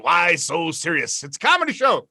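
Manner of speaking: ordinary talk, nothing marked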